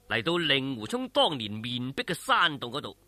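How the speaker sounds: usual speaking voice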